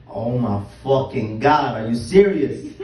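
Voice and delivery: loud, slow disgusted outburst. Acted. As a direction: blurt it out in horror. Reacting to swallowing piss.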